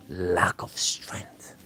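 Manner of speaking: slow breathy whisper of the phrase. Pretend. Whispering the diagnosis to a viewer. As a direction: breathe it out slowly, barely voiced.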